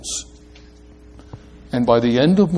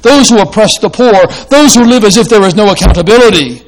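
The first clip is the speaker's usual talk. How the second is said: fervent preaching; 5 semitones higher, 6 dB louder than usual. Real, not acted. Preacher rising to fervent climax.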